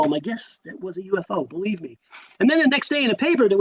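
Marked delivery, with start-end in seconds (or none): 0.2-2.0 much softer, understated comeback; retold insistence. Teen answering friends who laughed at him, quiet retold insistence. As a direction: drop the volume for a quiet, sure reply.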